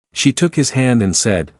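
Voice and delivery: ordinary delivery, nothing marked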